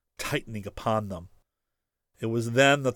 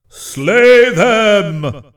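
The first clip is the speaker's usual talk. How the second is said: booming voice of the forest trees; thunderous telepathic voice; 11 semitones higher, 15 dB louder than usual. Acted. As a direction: proclaim it like an ancient forest god. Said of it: "pulsed the alien thought".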